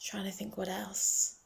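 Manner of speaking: soft unvoiced whisper to herself; hushed thinking-aloud whisper; whispered aside. Real, not acted. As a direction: murmur it while thinking. Muttered aside, searching memory.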